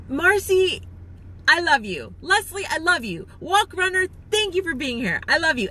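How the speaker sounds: ordinary talk, nothing marked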